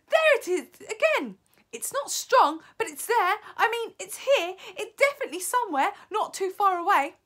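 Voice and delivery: high, loud, excited witch; insistent high shouting. A narrator's reading. First witch tracking the smell for the others, shouting. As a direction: insist urgently at the top of the range. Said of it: "shouted the first witch".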